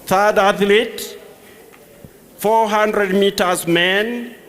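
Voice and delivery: loud, ringing roll call; stadium announcer. Real, not acted. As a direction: call names across a stadium.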